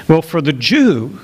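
ordinary delivery

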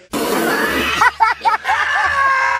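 loud shrieking laughter. Real, not acted. Bursts out laughing.

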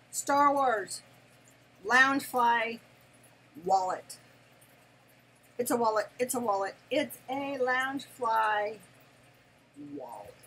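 loud, proud gushing over each word; excited announcing. Real, not acted. Fan proudly announcing her Star Wars wallet. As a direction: announce the prize with glee.

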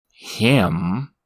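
a sneering imp. Acted. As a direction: scoff at the very idea. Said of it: "sneered the demon".

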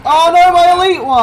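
high, loud cry of dismay; dismayed shout. Real, not acted. Player mourns his lost elite unit, dismayed shout. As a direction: wail it out loudly over the loss.

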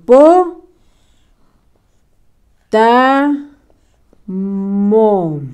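separated syllables called out; syllable dictation. Shown as a demonstration. Teacher dictating to pupils, syllables called out. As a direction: call the syllables one at a time. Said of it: "Y po".

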